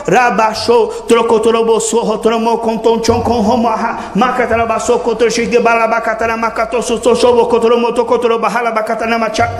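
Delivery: fast, even flowing tongues syllables; fluent tongues demo. Shown as a demonstration. Preacher showing the congregation fluent tongues, smooth flowing demo. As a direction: rattle off the heavenly language smoothly. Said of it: "Assim é a linguagem celestial".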